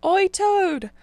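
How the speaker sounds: loud, high call across the road; Oi, yelled up high and loud. A narrator's reading. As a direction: shout to get his attention. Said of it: "they cried".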